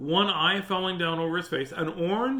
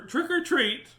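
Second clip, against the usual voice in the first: louder, much higher call; a little louder; 12 semitones higher, 5 dB louder, slower than usual. A narrator's reading. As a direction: call it out high to be heard. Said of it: "He said a little, uh, louder".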